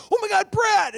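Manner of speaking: high, loud gushing cry of a name; excited greeting. Retold or imitated. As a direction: burst out like seeing an old friend. Actor greets him with a shout.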